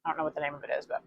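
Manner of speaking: talking through a mouthful of meat; mumbling around a bite; mouth full. Real, not acted. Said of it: "totally got a mouthful of meat here".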